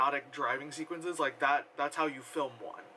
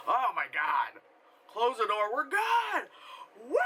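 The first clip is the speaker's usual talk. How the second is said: mock relief; 7 semitones higher than usual. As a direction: cheer like you just got away with it. Mocking noisy relieved characters.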